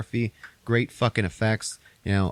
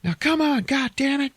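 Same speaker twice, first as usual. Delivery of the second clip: high, drawn-out desperate yell; hammed-up movie-hero outburst; mock screaming; 3 dB louder, slower than usual. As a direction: imitate the hero screaming an ultimatum. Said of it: "he starts screaming at her".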